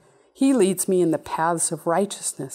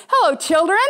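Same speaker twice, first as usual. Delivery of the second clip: higher, louder, kindly greeting voice; Jesus greeting; 8 semitones higher, 5 dB louder, faster than usual. Retold or imitated. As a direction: greet them like a gentle father. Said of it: "is gonna come up and go".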